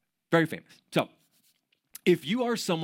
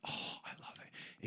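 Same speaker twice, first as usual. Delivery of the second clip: softer, whispered sigh of bliss; blissful whisper; 19 dB quieter than usual. Real, not acted. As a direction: breathe it like a happy memory. Blissful memory of beach nap.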